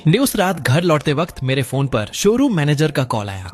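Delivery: usual speaking voice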